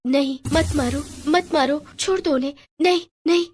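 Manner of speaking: high, frightened pleading; panicked girl. Acted. Girl begs attackers to stop in her dream, panicked. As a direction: cry out from inside a nightmare.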